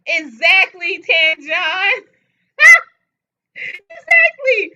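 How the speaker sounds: loud, high cry of agreement; emphatic shout. Real, not acted. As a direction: yell it out in full agreement.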